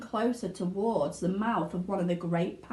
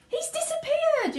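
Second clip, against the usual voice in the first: very high panicked yell; yelling mother; 3 dB louder than usual. A narrator's reading. Mother yelling as her son vanishes, very high. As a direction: shriek in alarm. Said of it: "yelled Missus Gloop".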